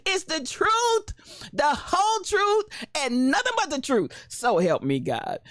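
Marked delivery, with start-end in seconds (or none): 0.0-4.1 mock oath. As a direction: swear it in grandly. Mock courtroom oath, slow.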